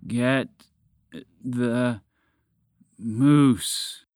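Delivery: straining dragon with last breath. Acted. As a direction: push out each word, exhausted. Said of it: "spoke with all the breath it could muster".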